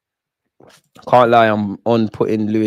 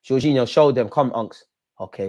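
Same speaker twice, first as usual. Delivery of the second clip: ordinary delivery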